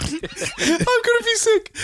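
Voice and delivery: high, slow, squeezed out through laughter; can barely speak for laughing. Real, not acted. Laughing at the failed jump, high and slow.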